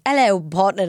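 half-hearted attempt at Australian; bad Australian accent. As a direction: fumble an Australian accent, imitating a kid. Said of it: "ordering ice cream the other day in an Australian accent".

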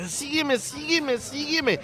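campy guy. Acted. Roommate leads him running.

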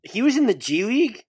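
surprised question. Real, not acted. Co-host checking the G League news, surprised. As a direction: question it with a big upward leap in pitch.

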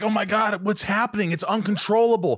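retold panic.

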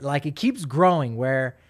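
ordinary delivery

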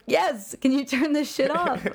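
high, exasperated comeback; high-pitched retort. Retold or imitated. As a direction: snap back in a high voice. Retorting to the Italian.

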